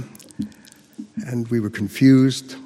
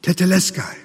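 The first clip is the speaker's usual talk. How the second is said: higher-pitched retold shout; one word, called out firmly; mild shout; 5 semitones higher than usual. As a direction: proclaim it with authority and determination. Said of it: "He shouted it".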